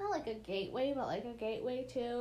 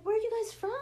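quoted bakery staff; 8 semitones higher, 3 dB louder, much faster than usual. Retold or imitated. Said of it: "They were like".

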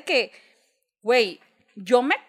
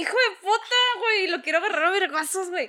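inner rage retold; 7 semitones higher, faster than usual. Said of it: "me acuerdo de".

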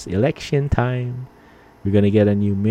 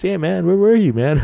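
raised high friendly call from a buddy; buddy's call; 10 semitones higher, 4 dB louder than usual. Retold or imitated. Buddy Heath calling out on the walk home, high and friendly.

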